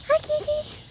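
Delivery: very high cooing greeting; cooing hello. Real, not acted. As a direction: coo hello to the little one. Greeting a pet.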